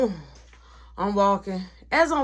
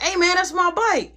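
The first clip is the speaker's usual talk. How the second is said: surprised claim; 9 semitones higher, 3 dB louder than usual. Retold or imitated. Roy spotting his stolen bike.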